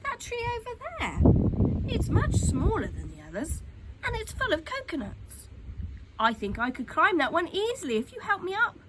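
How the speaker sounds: put-on a keen little boy pointing something out; young Toto, excited and hopeful; eager boy. A narrator's reading. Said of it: "cried Toto".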